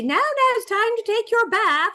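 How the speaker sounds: put-on high, sing-song mother calling a child to the bath; imitating a cartoon mother's bath-time call; mom's cartoon voice. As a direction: lilt it up high like a cartoon mom. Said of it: "suddenly you hear this voice say".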